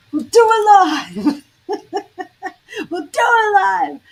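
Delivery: high, loud, laughing mock tirade; Bill O'Reilly rant. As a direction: bellow it like an anchor losing his temper on air. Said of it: "and he's going".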